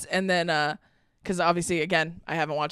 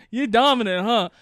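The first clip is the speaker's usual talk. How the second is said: mock suave line; 2 semitones higher, 4 dB louder than usual. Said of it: "the first time he goes".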